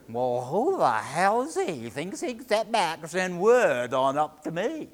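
loud, indignant outburst. A narrator's reading. Fisherman scorning the serpent's boss, indignant. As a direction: scoff loudly at the boss's nerve.

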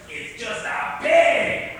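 the monster realises he's been tricked; furious discovery. Acted. Monster finds pig, furious.

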